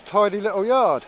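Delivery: ordinary talk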